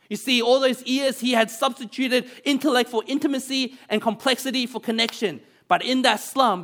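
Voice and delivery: preaching. Acted. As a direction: lift it like a pulpit climax. Preacher drives home the point.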